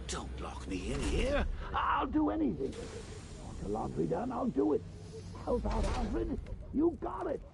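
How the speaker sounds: high, swooping begging; hammed-up desperate bargaining, leaping in pitch; frantic pleading. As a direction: plead wildly to be let out. Begging not to be locked in.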